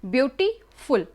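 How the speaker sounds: Beautiful split before फुल; slowly divide beautiful to show its syllables; syllable breaks. Shown as a demonstration. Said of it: "more syllable है".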